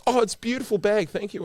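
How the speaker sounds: high, loud fluttery English thanks; delighted actor thanking fans for the gift; foppish English actor. Retold or imitated. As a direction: gush your thanks like a theatrical fop. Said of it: "he turned into a foppish English actor".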